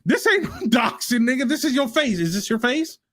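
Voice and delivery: fired-up arguing voice; heated confrontation. Real, not acted. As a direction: push it out high in disbelief, half laughing. Heated argument over showing a face.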